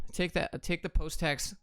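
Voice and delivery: normal talking voice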